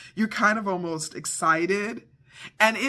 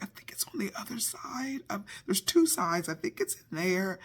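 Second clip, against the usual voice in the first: whispered unvoiced, half to herself; a secretive hushed guess; 3 semitones higher, 6 dB quieter than usual. Wife whispering a guess to husband, hushed whisper. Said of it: "I'm like".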